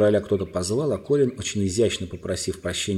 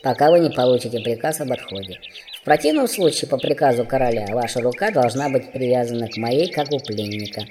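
formal dwarf; 3 semitones higher than usual. A narrator's reading. Dwarf addresses the young prince, formal. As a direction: address royalty stiffly as a dwarf.

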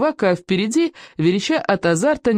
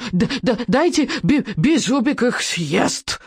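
slow, higher stuttering dragon wail; howling little dragon; 6 semitones higher, slower than usual. A narrator's reading. Little dragon howls at the boys, slow and stuttering. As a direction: let the hunger burst out stammering. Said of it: "Взвыл Беззубик".

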